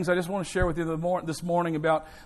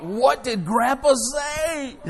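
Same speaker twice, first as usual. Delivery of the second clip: mock worried dad; 7 semitones higher, 4 dB louder, much slower than usual. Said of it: "And I thought".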